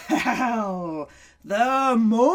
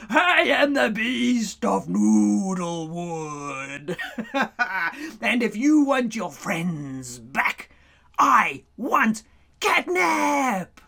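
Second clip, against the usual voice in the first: growling beast; slower than usual. Acted. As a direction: menace them like a big cat. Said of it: "he growled".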